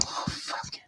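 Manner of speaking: whispered dog thought; whispered Bruno voice-over; voicing the dog. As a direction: give the dog a hushed, fed-up inner voice. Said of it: "Bruno's going".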